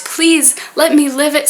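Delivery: ordinary talk, nothing marked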